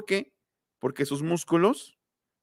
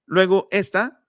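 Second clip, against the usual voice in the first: ordinary delivery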